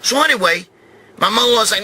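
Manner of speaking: usual speaking voice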